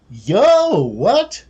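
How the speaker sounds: high, loud startled yo what; shocked yelp. Real, not acted. Streamer reacting to the Oni bean allergy, loud shocked yelp. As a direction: blurt it out in disbelief.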